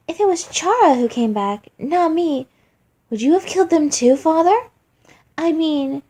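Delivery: put-on high, loud, sugary childish taunting; mock sweet taunt. Flowey taunts his weeping father with fake sweetness. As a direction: needle him in a fake-innocent sing-song voice.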